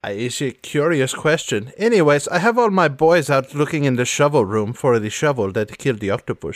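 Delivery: put-on theatrical accented villainess; Sans Serif, evasive. Sidestepping his question about the shovel, accented villainess.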